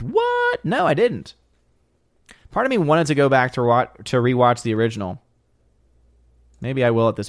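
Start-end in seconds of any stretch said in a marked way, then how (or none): 0.0-1.3 very high-pitched "what"; startled high yelp of disbelief; surprised. Real, not acted. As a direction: squeak it in disbelief. Reacting to surprising trivia from chat.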